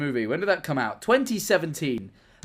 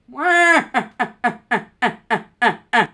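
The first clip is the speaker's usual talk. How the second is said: cackle; 7 semitones higher, 6 dB louder than usual. Acted. Laughs loudly after request.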